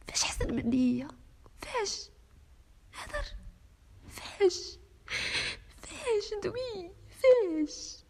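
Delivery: high, breaking voice asking again and again, some words slowed; tearful desperate questions. Acted. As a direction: beg for an answer through tears. Wife demands why the other woman.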